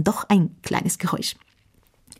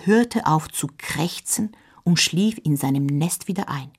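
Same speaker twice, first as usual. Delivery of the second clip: ordinary delivery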